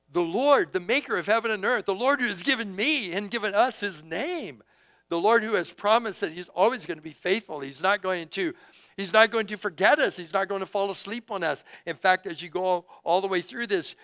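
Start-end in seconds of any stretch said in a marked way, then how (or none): 0.1-11.6 louder, higher preaching; preaching at full force. Acted. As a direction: proclaim it to the congregation. Preaching climax on God's faithfulness.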